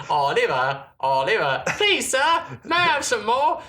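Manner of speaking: squeaky high orphan voice begging; hammed-up high Cockney urchin plea; mock Oliver Twist Cockney. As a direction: beg like a little Dickens orphan.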